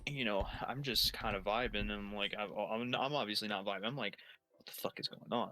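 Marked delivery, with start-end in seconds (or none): 4.6-5.5 hushed, half-voiced bewilderment; bewildered aside. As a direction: breathe it out under your voice, baffled. Acted bewildered thought among yelling guards.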